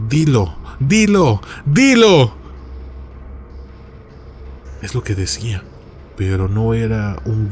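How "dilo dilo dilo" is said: drawn-out, high-pitched insistent cry; slow, stretched yell demanding an answer; insistent shout. A narrator's reading. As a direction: shout it insistently, each cry rising higher and louder. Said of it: "comenzó a gritar".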